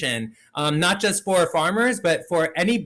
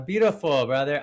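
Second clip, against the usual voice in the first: ordinary delivery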